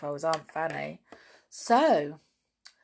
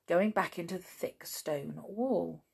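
usual speaking voice

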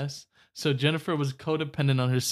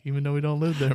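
ordinary talk

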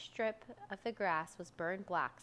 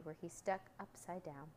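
plain reading voice

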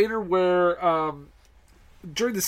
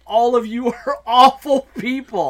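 loud, high, laughing mock judgment; laughing verdict; 6 semitones higher, 6 dB louder than usual. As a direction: laugh it out at the whole cast. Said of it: "Because I was just like".

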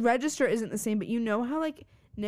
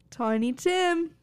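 put-on British accent; 4 semitones higher than usual. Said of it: "about your British accent".

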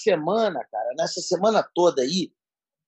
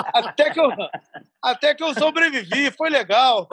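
laughing; 5 semitones higher than usual. Real, not acted. Laughing at punchline.

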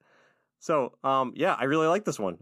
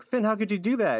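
upset Marcy; 9 semitones higher, 3 dB louder than usual. Retold or imitated. Marcy scolding Finn.

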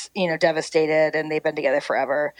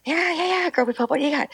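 high, bright-voiced fake enthusiasm, much higher; mock eager reply; 10 semitones higher, faster than usual. Hostess humoring the old preacher, high mock cheer. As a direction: chirp back with put-on cheer. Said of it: "I'm like".

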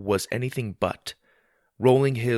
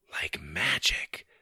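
soft breathy whisper of wonder; 3 semitones lower, 7 dB quieter, much slower than usual. Acted. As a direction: whisper it in awe. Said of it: "Susie whispered in wonder".

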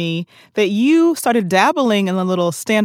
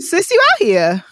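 high, playful cheer of admiration; hyping a friend; 8 semitones higher than usual. Real, not acted. Hyping up guest's many ventures.

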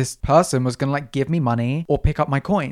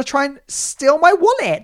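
dramatic indignation; 10 semitones higher, 5 dB louder, slower than usual. Retold or imitated. Dramatising fear of being robbed.

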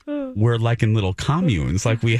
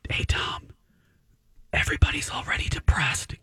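unvoiced stage whisper; a confiding whisper to Tom Brady; 5 semitones higher, 5 dB quieter than usual. Addressing Brady in aside.

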